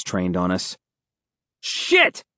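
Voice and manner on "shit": sudden high yelp of alarm; yell. A narrator's reading. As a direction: yell it in shock. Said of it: "I yell".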